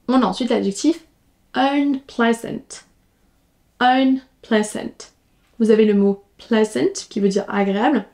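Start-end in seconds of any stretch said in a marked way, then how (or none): 1.5-5.1 slow drill. Shown as a demonstration. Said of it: "teacher drills the word slowly".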